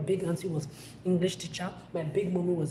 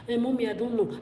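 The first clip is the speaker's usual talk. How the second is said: put-on higher, louder whiny child's excuse; innocent child; 6 semitones higher, 5 dB louder than usual. Young Adora lying to her big mommy, higher and whiny. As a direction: play dumb like a caught little girl.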